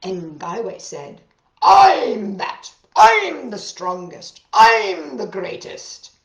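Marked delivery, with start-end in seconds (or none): 1.6-6.1 loud slow boastful bear voice; booming bear. A narrator's reading. Bear bellowing his boast at the chipmunk.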